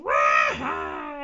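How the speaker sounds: big loud roaring laugh; hearty roaring laugh. Acted. Reader voicing Yeti laughing with the bird. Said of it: "Yeti was laughing with a hearty roar".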